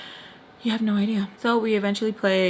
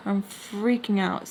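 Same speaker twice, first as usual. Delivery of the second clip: normal talking voice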